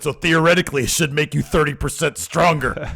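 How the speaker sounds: loud, high, hammed-up reasoning; imitated wrestler boast. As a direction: proclaim it like a proud wrestler. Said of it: "he said".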